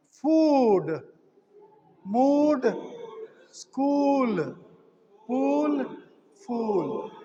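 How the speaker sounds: "food, mood, school, pool, fool" each with a drawn-out oo; long OO. Shown as a demonstration. Teacher coming to the long sound, long oo in each word. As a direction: stretch each vowel long, one word at a time. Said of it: "the long sound".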